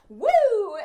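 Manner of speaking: put-on high, loud kid's woo; big playful "woo"; gleeful whoop. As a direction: whoop like a delighted little kid. Acting out a gleeful child.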